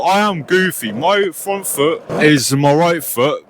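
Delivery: usual speaking voice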